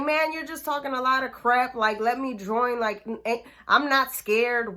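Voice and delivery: put-on a teen shouting she isn't scared; hothead teen. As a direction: throw it at him like a cocky fifteen-year-old. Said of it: "Just being a hothead".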